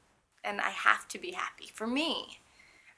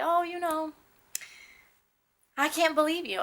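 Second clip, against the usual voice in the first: higher-pitched voice of someone who doubts; imitating a doubter; 8 semitones higher than usual. Patient quoting people who doubt her illness, mock disbelief. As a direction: lightly mimic a disbelieving person, pitched up.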